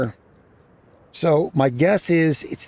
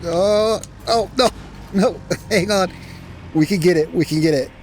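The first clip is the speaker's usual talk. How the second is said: excited; 7 semitones higher than usual. Real, not acted. Crane mishap, excited panic.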